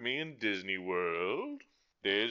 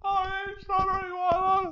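high dude voice; 7 dB louder than usual. Pretend. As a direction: answer like a squeaky buddy. Second dude in dialogue.

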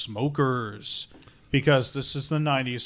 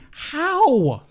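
incredulous; 13 semitones higher, 7 dB louder than usual. Real, not acted. Incredulous at crude oil gas.

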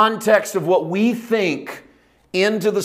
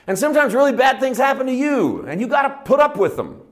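rising, higher preacher's fervor; preaching at full force; 3 semitones higher than usual. Acted. Preacher to his congregation, impassioned rising sermon. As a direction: drive the point home to the congregation.

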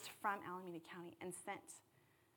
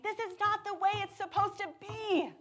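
high, loud, indignant cry; indignant Jesus; 12 semitones higher, 10 dB louder than usual. Pretend. Preacher voicing Jesus to the congregation, indignant and loud. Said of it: "there's that tone".

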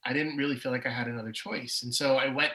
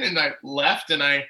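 ordinary delivery

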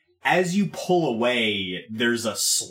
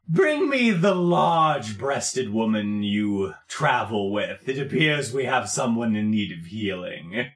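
commanding Wallace; 2 semitones lower than usual. Acted. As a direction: hand down the command at leisure. Game master plays Wallace.

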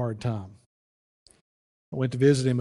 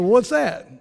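surprised neighbor; 11 semitones higher, 8 dB louder than usual. Retold or imitated. Bird voiced, high.

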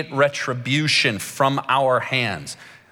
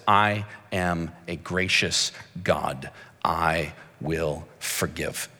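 much lower and slower, quiet and tender; gentle God; 11 semitones lower, 6 dB quieter, slower than usual. Retold or imitated. Preacher voicing God to the congregation, soft and tender. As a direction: let it sink in softly.

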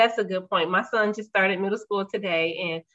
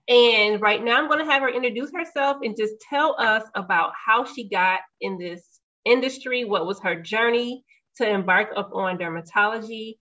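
ordinary delivery

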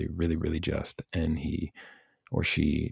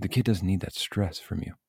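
usual speaking voice

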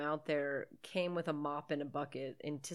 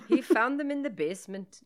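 higher, louder muddled Irish brogue; Irish accent attempt; 5 semitones higher, 8 dB louder than usual. Friend narrating the film plot, badly faked Irish accent. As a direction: push out a bad Irish lilt. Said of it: "I don't know what that was".

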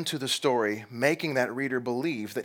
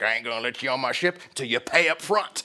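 gruff captain; 3 semitones lower than usual. Retold or imitated. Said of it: "the captain's like".

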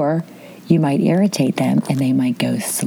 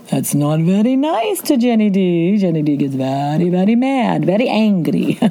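put-on Italian accent; 2 semitones higher than usual. Cheated out of her leftovers, mock-angry Italian accent. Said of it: "That's my Italian accent".

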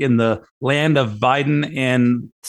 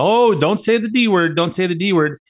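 much higher, flustered mock protest; mock alarmed dealer; 7 semitones higher than usual. Host mimics the dealer begging him not to say discrimination, higher. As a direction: squeal it like the word is forbidden.

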